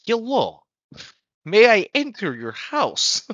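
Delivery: high, slow, overly polite voice; polite stranger voice. Retold or imitated. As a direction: play the creepy courteous visitor asking in. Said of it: "the other guy was like".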